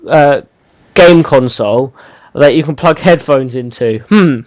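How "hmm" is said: sarcastic. Real, not acted. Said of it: "just taking the piss out of you".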